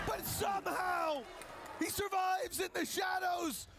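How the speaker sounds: high, stunned yell; shocked shout. Real, not acted. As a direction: react in disbelief, shouting. Shocked caster at survival.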